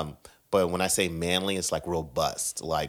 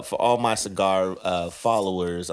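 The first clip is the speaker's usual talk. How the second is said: usual speaking voice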